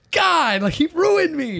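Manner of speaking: very high, slow whiny lament; scowling villain. Retold or imitated. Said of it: "So he's like".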